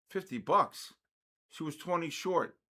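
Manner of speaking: ordinary delivery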